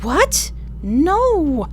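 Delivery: high, sharp cry of disbelief; shocked cry. Acted. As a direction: cry out as the screen dies. Console dies, shocked cry.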